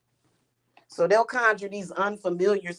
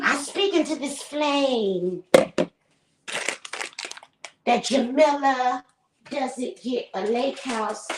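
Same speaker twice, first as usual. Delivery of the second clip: slow, high, solemn spell chant; mock incantation; 4 semitones higher, slower than usual. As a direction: parody a witch's candle spell. Mocking candle spell.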